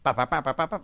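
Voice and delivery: punchy rhythmic brass "ba-ba-ba"; loud staccato horn imitation; imitated marching band. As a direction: mimic a blaring band with your mouth. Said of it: "the marching band is behind you".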